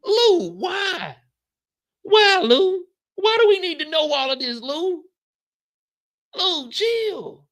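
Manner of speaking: high frantic plea; high pleading whine. Acted. Pleading with Lou.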